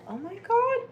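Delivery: leaps way up into a high, stunned oh my God; real horror at the twist; shocked gasp. Real, not acted. Host reacting to the murder twist, shocked high cry.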